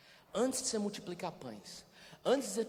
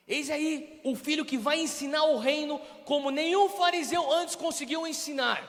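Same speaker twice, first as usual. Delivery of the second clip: high, loud, slow proclamation; booming mock God voice; 11 semitones higher, 12 dB louder than usual. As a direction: announce it like a showy herald. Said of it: "ele não falou".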